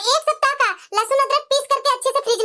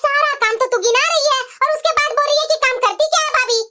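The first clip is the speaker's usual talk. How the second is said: exasperated daughter; 4 semitones higher than usual. Acted. Daughter exasperated at her mother's complaints, whiny plea. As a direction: plead and scold mom at once.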